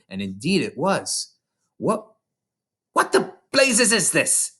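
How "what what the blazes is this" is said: much higher, alarmed shout; shaken shout. A narrator's reading. Headmistress shouting at the ghostly chalk, much higher. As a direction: bellow in shock. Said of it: "the Trunchbull shouted".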